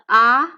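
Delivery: A said as a loud, open "ah"; open AH vowel. Shown as a demonstration. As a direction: say A as "ah", mouth fully open. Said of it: "fully open as for the A sound".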